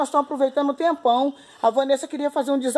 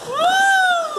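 high, loud whoop; excited whoop; 6 dB louder than usual. Real, not acted. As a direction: let out a big whoop. Diving into pool cheer.

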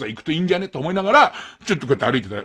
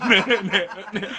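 ordinary talk, nothing marked

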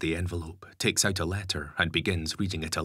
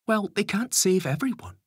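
higher, anxious mother; worried mum voice; 5 dB louder than usual. A narrator's reading. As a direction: press him for a promise. Lisa argues.

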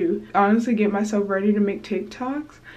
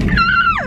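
high, loud squeal of delight; dramatic excited squeal; 4 dB louder than usual. Real, not acted. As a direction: shriek with joy over the fry. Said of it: "that was a bit dramatic".

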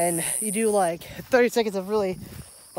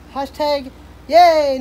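sarcastic cheer; 6 semitones higher, 10 dB louder, much slower than usual. Real, not acted. Sarcastic cheer after long chat.

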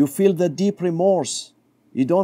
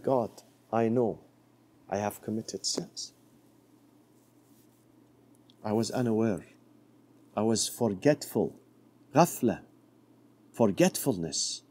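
humble prayer; 5 semitones lower, 7 dB quieter than usual. Acted. Young man praying to God.

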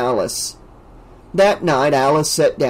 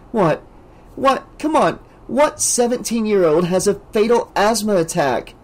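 upset Debbie; 7 semitones higher than usual. A narrator's reading. Debbie upset about the death.

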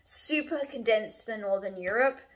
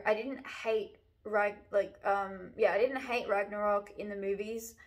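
ordinary talk

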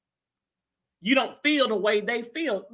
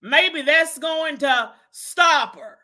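ordinary talk

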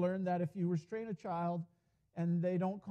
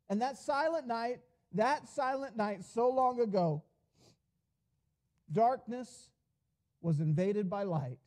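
higher and louder sermon voice throughout; fervent preaching; 6 semitones higher, 5 dB louder than usual. Acted. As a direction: preach it out with full conviction. Preaching at full force.

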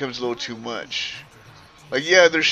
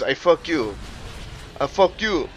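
imitated Haitian accent, "fuck you" with the "up" missing; Haitian drill sergeant; 2 semitones higher than usual. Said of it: "drill sergeant that was Haitian".

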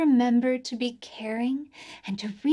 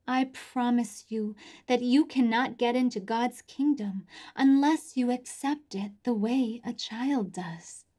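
ordinary talk

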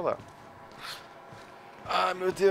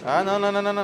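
usual speaking voice

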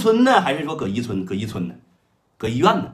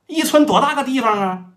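higher, keen underling voice, ready to jump; eager Third Brother; 9 semitones higher, 5 dB louder than usual. Retold or imitated. Third Brother eagerly agreeing to his elder brother's call, high and keen. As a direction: sound only too glad to help.

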